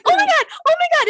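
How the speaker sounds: panicked yelp. Retold or imitated. Panicked when hamster bites finger.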